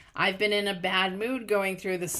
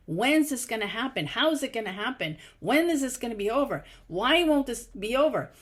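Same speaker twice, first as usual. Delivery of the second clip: higher, pressing, rising frustration; impatient mock demands; 5 semitones higher, 4 dB louder than usual. People holding on tight demand answers, mock impatient. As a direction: fire off acted questions, growing louder and higher.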